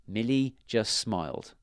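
plain reading voice

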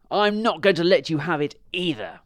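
a high, loud, spiteful dog; selfish dog. Acted.

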